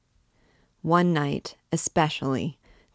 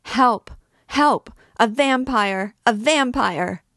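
much higher, much louder desperate shriek; shrieking for help; 8 semitones higher, 8 dB louder than usual. A narrator's reading. Trapped girl shrieking for help against the priest. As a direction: scream it with your last strength. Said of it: "made one last effort and shrieked".

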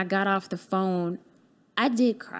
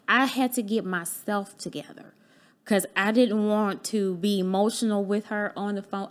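normal talking voice

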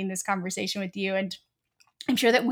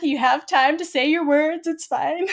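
higher, louder playful pep talk to oneself; mock self-coaching; 5 semitones higher, 5 dB louder than usual. Host playfully telling oneself to slow down, mock self-coaching. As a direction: act out urging yourself to slow down. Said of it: "I'm often like".

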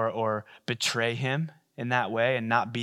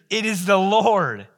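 slow, louder and higher, each word weighted; proclaiming; 6 semitones higher, 6 dB louder, slower than usual. Acted. Disciple telling Peter it is the Lord, slow and loud. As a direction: announce it like a revelation.

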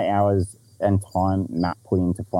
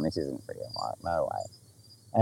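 much softer, slow disbelieving murmur; stunned inner voice; 6 semitones lower, 10 dB quieter, much slower than usual. Retold or imitated. To himself on spotting the snake, a soft stunned murmur. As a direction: hush it like you can't believe your eyes. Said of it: "sitting there in my head going".

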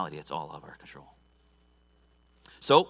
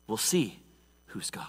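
hushed whisper; 5 semitones lower, 8 dB quieter than usual. Shown as a demonstration. Preacher building suspense before the showdown, whisper. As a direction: whisper "who's God" barely audible.

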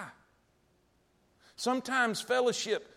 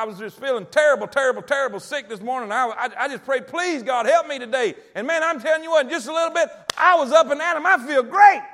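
acted-out caller bursting with good news, getting louder; excited testimony; 3 semitones higher, 8 dB louder than usual. Church member phones the preacher about answered prayer, excited and building. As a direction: build from loud to a high, jubilant shout.

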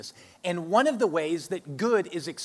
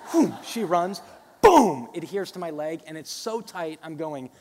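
ordinary talk

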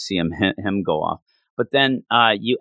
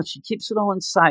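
ordinary talk, nothing marked

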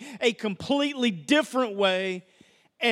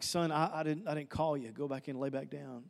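put-on low, soft, drowsy old priest's voice; a half-asleep Eli waving the boy back to bed; sleepy old priest; 8 semitones lower, 10 dB quieter than usual. Priest Eli, woken at night, answers Samuel in a low, drowsy murmur.

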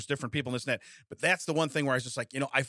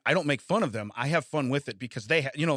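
usual speaking voice

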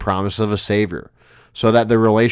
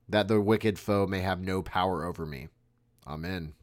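prayer recitation; 6 dB quieter than usual. Acted.

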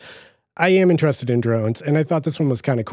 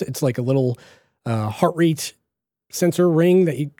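normal talking voice

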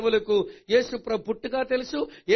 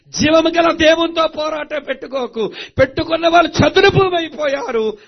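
loud, high preacher's shout; thundering warning; 7 semitones higher, 12 dB louder than usual. Real, not acted. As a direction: hammer the warning home at full force. Preaching at full force.

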